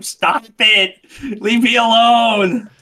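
high, loud exasperated yell. Retold or imitated. Player yelling at his practice partner in chat, exasperated yell. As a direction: yell it out, stretching the last plea. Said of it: "I'm just yelling at him".

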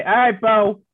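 calling out. Acted. Character calls out to Bo.

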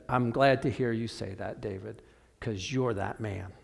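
soft and low, letting it land; quiet reveal. Retold or imitated. Nathan quietly telling King David he is that man. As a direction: drop the voice for the final blow.

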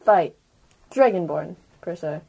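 normal talking voice

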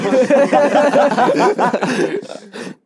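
loud, high burst of laughing; hearty laughter. Real, not acted. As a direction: crack up laughing, long and high. Group cracks up over edit joke.

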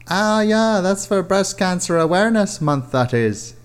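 put-on high breezy young woman; girlfriend voice. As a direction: pitch it up as the girlfriend.

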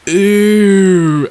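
high, loud grossed-out ew; disgusted crowd. Acted. Crowd smelling Fire's smoke, disgusted ew. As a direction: recoil from the smoke.

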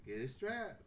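ordinary talk, nothing marked

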